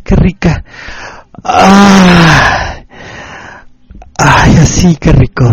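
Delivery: mock climax. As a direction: push it up toward a hammed-up climax. Erotic message climax.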